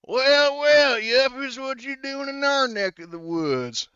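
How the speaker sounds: loud, slow, high-pitched yokel; backwoods local. Acted. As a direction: crow it loud and high, stretching the words. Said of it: "this guy says".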